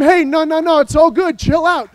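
breezy dismissal of "it's all good", no lowering; casual brush-off of "it's all good"; dismissive naysayer. Retold or imitated. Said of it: "naysayers telling him".

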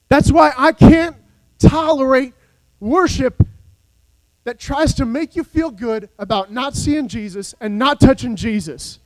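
rising, ringing sermon delivery; full-force Sunday preaching; fiery preaching. Acted. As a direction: preach it to the back pew.

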